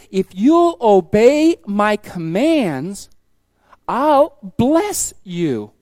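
slow, solemn, measured promise; slow, weighty voice of God. Acted. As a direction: draw each word out like a divine promise.

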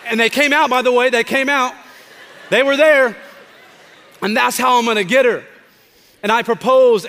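loud, high, thrilled retelling; crowd-lifting excitement at the proposal story; excited. Retold or imitated. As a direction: ramp it up like the big moment is happening. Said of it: "I started getting excited".

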